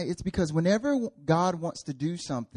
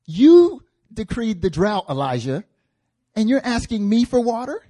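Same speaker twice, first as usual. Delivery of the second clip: incredulous challenge; 6 semitones higher, 9 dB louder than usual. Acted. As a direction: challenge him in raised-pitch disbelief. Acts out widow challenging Elijah.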